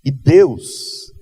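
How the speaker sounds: loud, high, fervent preacher's call; preaching at full force. Acted. Pastor rousing the congregation about miracles, full-force preaching.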